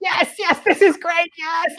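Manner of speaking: very high-pitched, excited child imitation; delighted little girl. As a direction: squeal like a thrilled kid. Voices his delighted young daughter.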